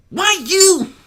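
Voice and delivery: high, loud furious filly yelp; angry snap. Acted. Skulu lunging at Apple Bloom, loud and angry. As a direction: snap it as she lunges. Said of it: "Skulu snapped".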